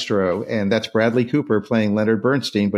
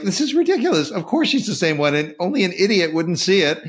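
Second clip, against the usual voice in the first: much higher, mock-incredulous; exasperated; 8 semitones higher than usual. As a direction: throw up your hands at the absurdity. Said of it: "He's basically saying".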